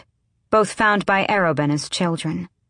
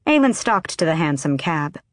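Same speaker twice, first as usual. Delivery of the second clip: usual speaking voice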